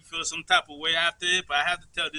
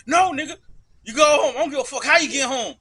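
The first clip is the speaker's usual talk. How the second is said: loud, angry go home; loud order; 7 semitones higher, 8 dB louder than usual. Retold or imitated. Brother on the phone refusing to help, loud and angry. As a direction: tell him off hard. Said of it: "you called me".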